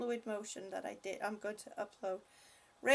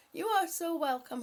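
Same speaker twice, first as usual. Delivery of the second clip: usual speaking voice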